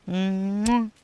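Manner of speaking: high "mwah" kiss sound; affectionate high-pitched mwah; blown kiss. Real, not acted. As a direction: send a smacking kiss to the viewer. Blowing viewers a kiss.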